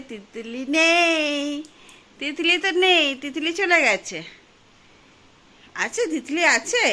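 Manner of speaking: high, loud sing-song teasing; playful peekaboo voice. Pretend. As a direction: tease her like hide-and-seek. Mother playing peekaboo with child.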